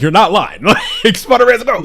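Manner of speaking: high, loud, laughing agreement; excited agreement. Real, not acted. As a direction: gush it with delight. Gleeful agreement about Spider-Man.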